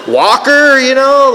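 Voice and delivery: high, drawn-out scoff; acted-out judgmental ridicule; mocking disbelief. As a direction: stretch the word in incredulous scorn. Mocking the newcomers in his head.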